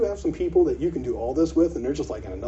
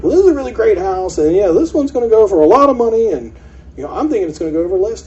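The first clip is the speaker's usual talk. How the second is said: gushing listing agent; 8 semitones higher, 7 dB louder than usual. Retold or imitated. Agent hyping a house.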